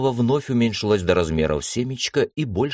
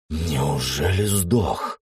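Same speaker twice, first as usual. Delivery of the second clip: slow gloating; much slower than usual. Acted. Emperor eyes the failing artifact.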